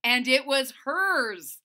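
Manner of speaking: loud, slow, thrilled; excited. Acted. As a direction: savor the joy loudly. Excited narration.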